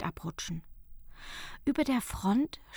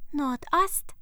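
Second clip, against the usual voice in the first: put-on higher, brighter girl's line; Fenja's voice; 6 semitones higher, 4 dB louder than usual. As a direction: call out the direction as the girl. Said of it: "sagte Fenja". (Fenja ruft leise und hell)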